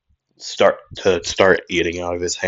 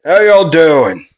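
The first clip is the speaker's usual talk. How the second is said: louder, higher, drawn-out call; slow, raised-voice holler; yelled greeting; 6 semitones higher, 6 dB louder, slower than usual. Acted. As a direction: yell a greeting into the room. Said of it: "as he would yell in".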